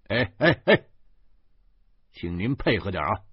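impatient. A narrator's reading. Other policeman impatiently urging the narrator to cooperate. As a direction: hurry him along. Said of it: "另一个警察有点急".

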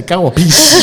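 loud, laughing mock grumble; mock outburst. Tour guide's inner thought about a slapping kid, loud laughing grumble. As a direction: blurt it out, half laughing. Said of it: "心想".

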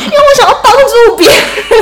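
loud, high-pitched self-defence through laughter; laughing protest. Real, not acted. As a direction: burst out laughing while protesting. Defends herself against teasing, laughing.

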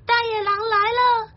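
very high, wailing alarm; acted panic, pitched right up; frightened cry. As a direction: cry out high as scared animals. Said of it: "大家都害怕地哭了".

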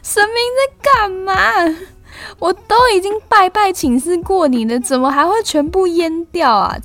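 音调很高、带哭腔地哀嚎抗议; high-pitched, exasperated mock wail; 夸张哭腔. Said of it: "我真的是超级大哭欸". (誇張哭腔，高聲哀怨質問)

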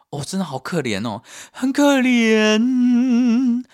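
high, slow, stretched whine of self-pity; mock pity. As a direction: drag out the pity like a sad puppy. 自怜地拉长感叹. (拖长高音装可怜)

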